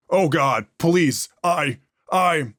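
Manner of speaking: choked pleas for help; desperate pleading. Acted. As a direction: plead through the pain, breaking off. Retching, begging in pain.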